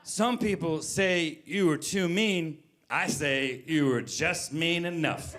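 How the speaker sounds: slow, deliberate quoted compliment; ponderous recital. Retold or imitated. Comedian reading out his text to an actor, slow and ponderous. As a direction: draw it out like a solemn tribute.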